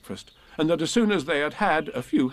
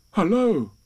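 lightly voiced bear, raised pitch; a hint of a higher, louder storybook bear; Pooh; 8 semitones higher, 5 dB louder than usual. A narrator's reading. Pooh greeting the stranger at his door, lightly voiced and higher. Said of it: "said Pooh".

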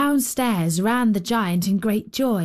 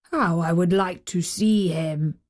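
low, lightly voiced giant; a hint of a giant, lower and longing; wistful giant; 4 semitones lower than usual. A narrator's reading. Giant longing for his little friend, lightly voiced and low.

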